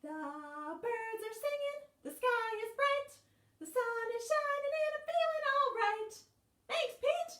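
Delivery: sing-song it, high and delighted; joyful squirrel. Acted. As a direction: Squirrel thanks Pete, very high and bright. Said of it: "said Squirrel".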